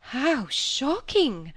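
high, very slow exclamation; duly shocked. A narrator's reading. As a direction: draw out the scandalised horror. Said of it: "duly impressed".

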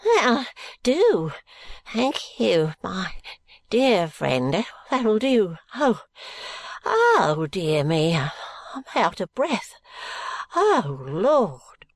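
put-on high, wheezing old miser gasping for air; breathless old Smallweed. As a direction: pant it out like a shaken old man. Smallweed voiced, breathless after shaking.

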